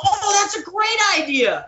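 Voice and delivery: high, drawn-out mock delight; gushing, stretched and way up high; imitated enthusiasm. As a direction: imitate a boss loving the idea. Director's keen reply, imitated.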